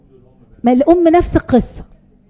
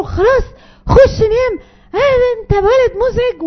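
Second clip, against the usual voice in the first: put-on high, exasperated snapping at the child; losing temper; 10 semitones higher than usual. Shown as a demonstration. Mother, fed up, snaps at the nagging child in a high voice. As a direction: show the wrong way, fed up. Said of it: "الغلط جدًا إن أنا عليت صوتي".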